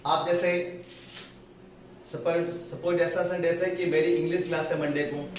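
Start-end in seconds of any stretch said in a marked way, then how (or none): none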